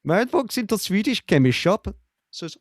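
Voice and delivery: a hammed-up Swedish accent for the joke; playful fake Swedish accent; mock Swedish accent. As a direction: tell the pun in a cheesy Swedish lilt. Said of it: "Great accent, though".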